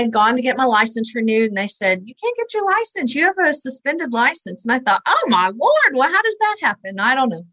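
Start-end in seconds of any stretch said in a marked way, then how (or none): 2.0-4.6 mock official refusal. Clerk's refusal acted out. 5.1-7.0 high-pitched retold bewilderment; acted-out dismay. Young woman learning her license is suspended, acted-out dismay.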